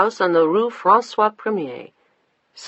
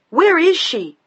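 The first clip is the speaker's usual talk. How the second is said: high, loud shriek; 12 semitones higher, 7 dB louder, slower than usual. A narrator's reading. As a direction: shriek the question in panic. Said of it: "Diana was shrieking".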